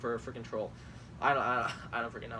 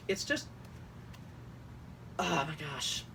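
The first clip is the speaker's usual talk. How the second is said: ordinary talk, nothing marked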